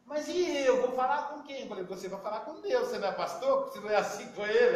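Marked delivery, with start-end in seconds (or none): 0.1-1.6 higher and louder, mock outraged; indignant protest. Friend protests he has nobody to talk to, higher and louder. 1.8-4.8 louder, playful jab; teasing retort. Retold or imitated. Speaker teases the pastor friend, louder and playful.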